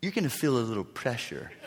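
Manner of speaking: soft, low understatement; imitating a reassuring doctor; soothing euphemism. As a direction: say it gently, downplaying it. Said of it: "that's a euphemism".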